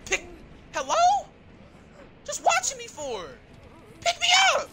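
yelling for help. Real, not acted. Calling teammate.

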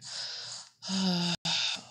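long breathy sigh; drawn-out role-play sigh; sad sigh. Pretend. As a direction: sigh out slowly, pretending sadness.

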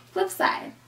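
breathy whispered sign-off. Real, not acted. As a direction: whisper the last words. Unvoiced sign-off.

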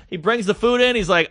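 ordinary talk, nothing marked

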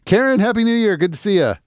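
high, bright burst of hello; excited greeting. Real, not acted. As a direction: greet her with a big lift. Greeting a chat viewer excitedly.

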